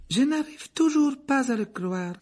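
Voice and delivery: high, pensive barmaid voice; musing barmaid. A narrator's reading. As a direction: wonder aloud, lost in thought. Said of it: "d'un air songeur".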